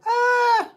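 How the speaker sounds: loud, very high cry of "ah"; sharp yelp. Real, not acted. Reacting to a chat comment, high loud yelp. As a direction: yelp suddenly at the top of your voice. Startled outburst in the chat banter.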